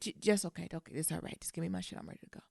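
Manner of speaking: just let it go, deflated; fading resigned surrender; resigned giving up. Retold or imitated. Retold giving up to agents.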